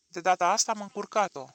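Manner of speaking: plain reading voice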